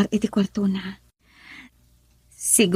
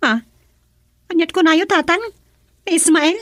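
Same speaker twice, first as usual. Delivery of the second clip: startled; 7 semitones higher, 4 dB louder, faster than usual. Acted. Daughter reacts to father, startled.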